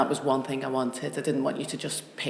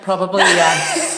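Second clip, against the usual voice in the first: laughing; 3 semitones higher, 9 dB louder, slower than usual. Real, not acted. Laughing admission of influence.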